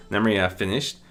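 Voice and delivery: ordinary delivery, nothing marked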